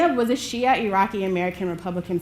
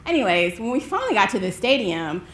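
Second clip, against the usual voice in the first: plain reading voice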